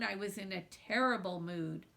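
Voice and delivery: usual speaking voice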